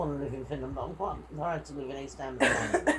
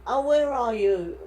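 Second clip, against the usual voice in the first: a slower, higher and louder call; 7 semitones higher, 9 dB louder, slower than usual. Retold or imitated. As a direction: call out slowly to find them. Driver calling out retold.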